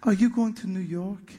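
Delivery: put-on mild French accent asking a stranger for a ride; sweet French accent. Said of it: "in a very sweet French accent".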